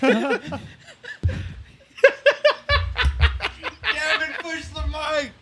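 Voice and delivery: high-pitched call. Real, not acted. Calling out to dog.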